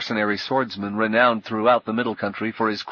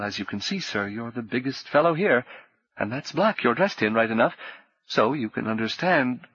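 a jittery tavern keeper babbling to appease a dangerous guest; nervous innkeeper; 2 semitones higher than usual. Acted. Innkeeper voiced, higher pitch.

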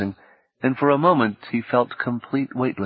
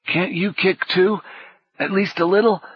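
panting; 10 semitones higher than usual. A narrator's reading. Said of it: "he panted".

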